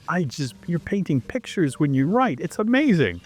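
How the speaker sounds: amazed. Retold or imitated. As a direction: marvel at the writing, climbing in pitch. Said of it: "I'm looking at him like".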